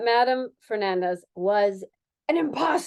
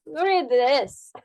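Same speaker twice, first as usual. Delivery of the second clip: put-on Spanish accent; 4 semitones higher, 5 dB louder than usual. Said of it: "doing Spanish".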